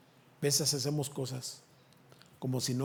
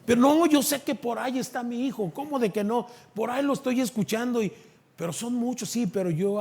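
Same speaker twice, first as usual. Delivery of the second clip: high, louder insistence; insistent mother; 8 semitones higher, 7 dB louder than usual. Retold or imitated. Mother insisting her child is there, high and firm. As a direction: refuse to be talked out of it.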